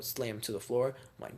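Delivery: ordinary talk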